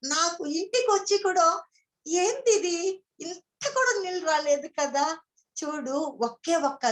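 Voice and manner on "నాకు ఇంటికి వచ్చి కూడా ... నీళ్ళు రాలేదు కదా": amazed. Retold or imitated. Amazed she didn't cry.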